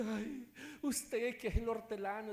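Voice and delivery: high, whimpering appeal; acted crying voice; imitated tearful plea. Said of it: "Estaba llorando".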